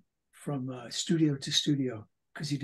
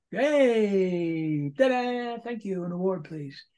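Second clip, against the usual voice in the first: showman voice; 4 semitones higher, 5 dB louder, much slower than usual. Retold or imitated. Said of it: "talk to myself".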